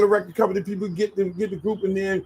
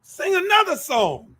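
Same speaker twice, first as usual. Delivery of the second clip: higher, drawn-out urging; eager demand; 9 semitones higher, slower than usual. Retold or imitated. Host urges the auditioning group on, eager demand. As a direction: urge them on, stretching each word.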